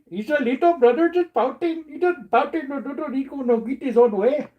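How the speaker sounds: put-on high, whiny kid-brother voice; pouting little brother. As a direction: sulk like a spoiled little brother. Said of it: "Sounds like a little brother pouting".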